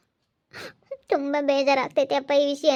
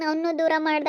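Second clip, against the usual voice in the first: ordinary talk, nothing marked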